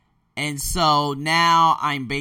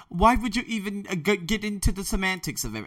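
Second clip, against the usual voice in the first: higher-pitched put-on British voice; mock British accent; 7 semitones higher than usual. Podcaster mimicking a jargon-loving guy, mock British accent. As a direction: imitate an annoying Brit in a British accent. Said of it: "that British accent".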